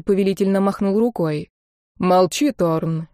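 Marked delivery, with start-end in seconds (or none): none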